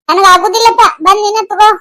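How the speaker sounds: loud, huffy refusal; irritated, voice pushed up and loud; angry refusal. Acted. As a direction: snap back crossly at father. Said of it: "ಸಿಟ್ಟಿಗೆ ಎದ್ದಾಳಕಿ".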